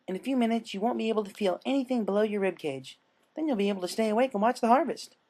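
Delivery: cheerfully menacing, like a party host; sweet creepy Pinkie. Acted. Pinkie explains the numbness to Dash, high and singsong.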